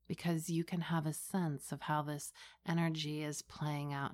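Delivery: hushed and unhurried, close to the ear; right in your ear. Acted. Leads a guided meditation.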